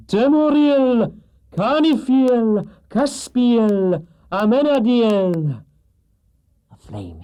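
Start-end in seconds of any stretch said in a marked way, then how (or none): 0.1-5.6 high, slow, loud incantation; loud summoning cry. Acted. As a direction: call the spirits out, stretching each name. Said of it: "cried in a loud voice".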